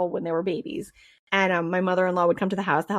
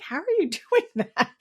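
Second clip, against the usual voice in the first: high, quick question broken by a laugh; laughing disbelief; 10 semitones higher, faster than usual. Retold or imitated. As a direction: laugh through the husband's baffled question. Husband baffled by her sensing babies.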